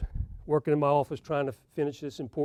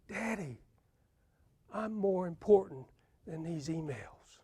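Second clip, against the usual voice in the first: lightly voiced little girl, much softer; soft child plea; 9 dB quieter than usual. Daughter softly tells her busy father she matters more, lightly voiced child. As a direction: say it gently like a hurt child.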